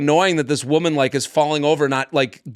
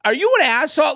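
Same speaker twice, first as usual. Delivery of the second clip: high, incredulous mock yell; retold yelling; 11 semitones higher than usual. Man confronting the heckling woman, mock yelling. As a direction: act out indignant disbelief. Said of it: "as I'm yelling at Samantha".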